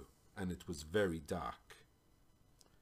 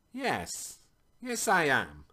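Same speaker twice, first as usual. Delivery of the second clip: grave, deliberate confirmation, pitched up; slow, serious tone; 6 semitones higher, 5 dB louder, slower than usual. A narrator's reading. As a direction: answer seriously, letting each word land. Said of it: "said in a slow, serious tone".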